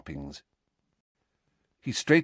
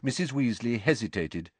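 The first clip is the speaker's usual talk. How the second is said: ordinary delivery, nothing marked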